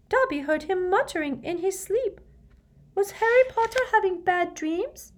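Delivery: very high, timid squeak; concerned high-pitched house-elf; timid squeaky elf. A narrator's reading. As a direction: ask timidly in an elf's squeak. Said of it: "looking timidly at Harry".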